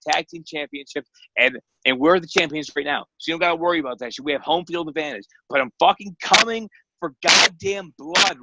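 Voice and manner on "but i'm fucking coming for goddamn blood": promo shout. Real, not acted. Wrestler's promo threat to rivals.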